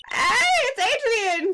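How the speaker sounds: high, loud, slow excited call; calling out a name in happy surprise; surprised call. Acted. Girl spots Adrian in the warehouse, high excited call.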